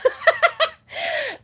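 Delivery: high, loud burst of laughter. Real, not acted. Vlogger amused at picturing her partner's reaction, bursting laugh. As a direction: crack up at your own line.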